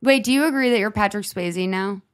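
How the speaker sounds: ordinary talk, nothing marked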